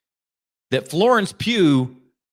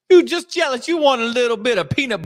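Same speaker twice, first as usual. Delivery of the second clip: sing-song taunt, pitched up; teasing, higher; 7 semitones higher than usual. Acted.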